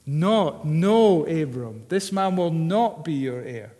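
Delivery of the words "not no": "Not" then "no" loud and punched; emphatic no. Shown as a demonstration. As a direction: hammer the refusal home. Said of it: "not" there is emphatic".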